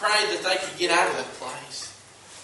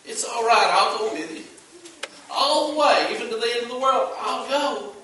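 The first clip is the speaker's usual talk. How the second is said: impassioned, pitched up and swelling; raised, pleading preacher's assurance; fervent promise; 5 semitones higher, 3 dB louder than usual. Acted. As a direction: promise it with all your heart. Preacher voices Christ's promise, rising fervor.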